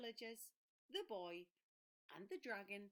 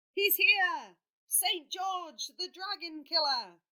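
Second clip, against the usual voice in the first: much higher, loud excited cry; excited announcement; 8 semitones higher, 14 dB louder than usual. Acted. Villager announces the dragon killer, loud and excited. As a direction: herald the hero's arrival.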